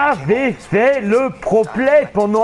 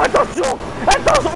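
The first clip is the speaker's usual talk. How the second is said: sharp, much higher yelp of warning; alarmed warning cry; 11 semitones higher than usual. Real, not acted. Streamer warning himself as the bike nears rocks, high alarmed cry. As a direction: yell it as the rock comes.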